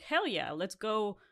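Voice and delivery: ordinary delivery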